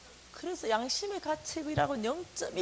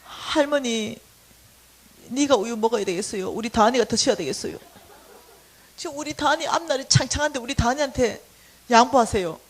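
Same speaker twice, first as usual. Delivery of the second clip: retold brazen plea; 9 dB louder than usual. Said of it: "이렇게 말했어요".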